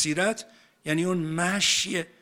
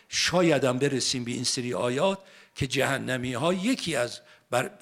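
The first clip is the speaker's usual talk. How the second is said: ordinary talk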